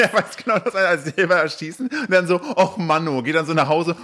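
high, whiny sulking murderer. Pretend. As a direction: pout like a murderer with a bad day. Voicing the frustrated murderer.

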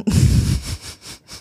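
airy, voiceless little laugh; breathy giggle. Real, not acted. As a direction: giggle under your breath before answering. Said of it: "laugh before teasing answer".

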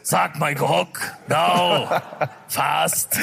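put-on low Austrian-accented action-star commands; Arnold. Host does dirty talk as Arnold, low Austrian-accented impression. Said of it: "Wie redet ein Arnold?".

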